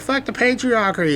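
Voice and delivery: mock [name]. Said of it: "She's like".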